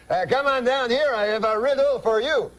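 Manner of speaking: high, excited French-Canadian calling; Jean-Luc's French-Canadian accent. Acted. Uncle runs in calling his wife, high French-Canadian accent. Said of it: "That's how he talks".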